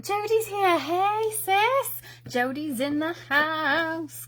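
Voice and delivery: high, singsong shout-out; playful excited hail. Real, not acted. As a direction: hail a close pal, high and drawn out at the end. Playfully hailing sister who joins.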